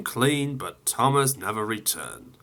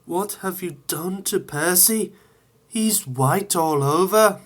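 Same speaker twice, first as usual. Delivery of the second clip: higher, lightly voiced startled question; surprised signalman; 8 semitones higher than usual.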